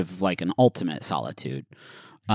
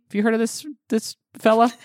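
teasing high voice; 12 semitones higher, faster than usual. Acted. Teasing question, pitch up high.

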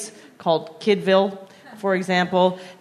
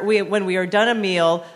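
normal talking voice

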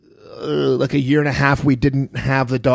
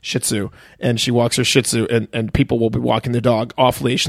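ordinary talk

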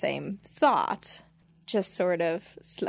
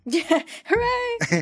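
mock cheer; 12 semitones higher, 3 dB louder, much slower than usual.

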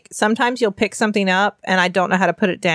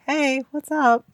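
put-on slightly higher kid voice; child voice; 7 semitones higher, slower than usual. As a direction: say it like a seven-year-old. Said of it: "it was a child voice".

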